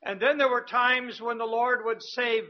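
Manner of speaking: usual speaking voice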